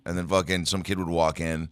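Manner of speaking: ordinary delivery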